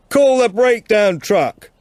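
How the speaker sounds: loud, high call over a gale; shouting in the wind. Acted. Martin tells Tilda to use her radio, shouting over the wind. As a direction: yell the practical answer across the snow.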